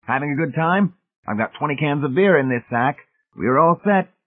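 high-pitched, jovial schemer; crafty guest, pitched well up; crafty party guest. Acted. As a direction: announce the beer in a lifted, hearty voice.

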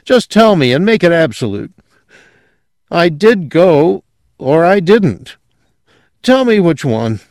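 desperate demand, pitched high. A narrator's reading. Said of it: "he said desperately".

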